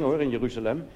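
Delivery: normal talking voice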